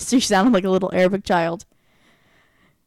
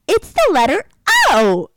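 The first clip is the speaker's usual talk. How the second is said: put-on high, loud little-kid squeal; tiny four-year-old; 9 semitones higher, 6 dB louder than usual. Four-year-old finding the big O in I Spy, high excited imitation. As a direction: call it out like an excited small child. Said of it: "tiny little four-year-old runs over".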